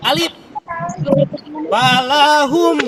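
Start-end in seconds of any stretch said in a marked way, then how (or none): 1.7-2.8 Balahum slow, higher, louder, m held; pressed m. Shown as a demonstration. Teacher showing pupils idhar syafawi, slow. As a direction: hold the m of Balahum before going on. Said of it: "enggak langsung, agak ditekan dulu".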